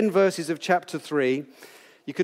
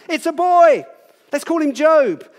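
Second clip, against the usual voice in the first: high, loud, delighted cry of a new parent; joyful announcement; 11 semitones higher, 8 dB louder, slower than usual. Acted. Happy parents name their newborn son, delighted high loud call. Parents celebrating a baby's birth.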